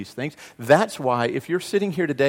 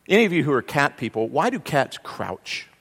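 ordinary delivery

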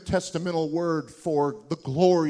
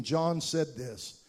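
ordinary talk

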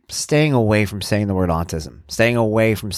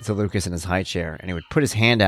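ordinary talk, nothing marked

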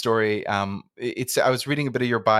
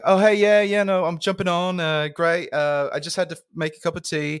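high-pitched, put-on English accent; English accent, pitched well up; imitated English accent; 8 semitones higher, 3 dB louder than usual. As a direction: play a chirpy English colleague joining the call. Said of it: "I liked your English accent".

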